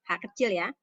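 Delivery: ordinary talk, nothing marked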